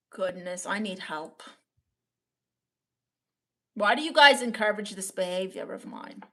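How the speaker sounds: ordinary delivery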